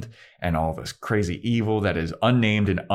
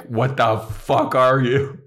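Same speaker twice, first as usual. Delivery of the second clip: louder, slow, furious demand; rough demand of a threatening sister; angry demand; 5 semitones higher, 4 dB louder, slower than usual. Retold or imitated. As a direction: slam the words at her. Buffy shoves Dawn, demands answer.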